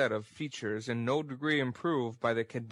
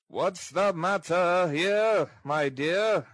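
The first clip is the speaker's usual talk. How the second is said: put-on loud, slow, high-pitched old rogue; slow, raised character voice; 6 semitones higher, 5 dB louder, slower than usual. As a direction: draw it out in an oily, inquiring singsong.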